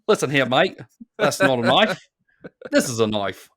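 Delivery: put-on a light Australian accent doing the Crocodile Dundee line; mock Australian tough-guy swagger, lightly voiced. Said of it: "really great accents that you attribute".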